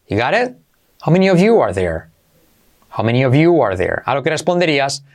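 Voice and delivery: ordinary talk, nothing marked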